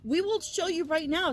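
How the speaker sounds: high, pleading protest from the accused brothers; panicked brothers. Retold or imitated. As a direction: squeak out the denial like scared men caught out. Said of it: "brothers are like".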